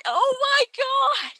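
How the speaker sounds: high, slow startled cry; shocked scream. Real, not acted. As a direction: gasp out in real shock. Sudden monster attack.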